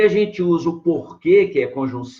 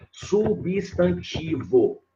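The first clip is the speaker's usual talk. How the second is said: slow, spelled-out emphasis; 2 semitones higher, much slower than usual. Shown as a demonstration. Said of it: "Olha a classe gramatical aí".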